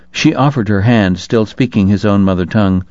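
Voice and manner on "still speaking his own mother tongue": plain reading voice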